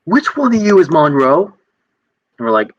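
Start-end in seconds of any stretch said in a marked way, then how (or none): 0.0-1.5 a high, pushy drill-sergeant demand. Retold or imitated. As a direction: demand the name like a cocky sergeant. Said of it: "And he goes".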